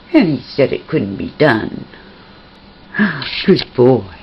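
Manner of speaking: usual speaking voice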